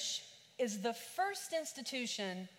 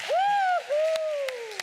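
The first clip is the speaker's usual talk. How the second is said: high, loud, sing-song whoop; sing-song woo hoo; 12 dB louder than usual. Real, not acted. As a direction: whoop it up joyfully. Pastor cheering back to congregation.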